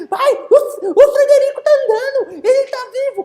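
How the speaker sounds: put-on high, loud thrilled kid; excited child. Boy excitedly telling his father the turtle lives. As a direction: blurt the news up high, overjoyed.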